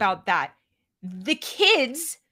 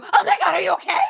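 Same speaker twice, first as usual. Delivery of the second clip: panicked parent; 7 semitones higher, 5 dB louder, faster than usual. Retold or imitated.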